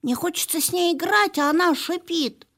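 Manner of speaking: high, whiny puppy complaint; whiny dog voice. Acted.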